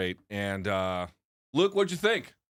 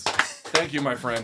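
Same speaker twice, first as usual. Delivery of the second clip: ordinary delivery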